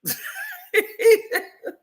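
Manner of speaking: high-pitched giggling laugh; helpless high cackle. Real, not acted. Laughs at the photo story.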